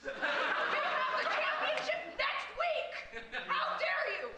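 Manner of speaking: slow, loud indignant yell; outraged shout. Acted. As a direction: blow up at the teammate. Coach outraged at cheating.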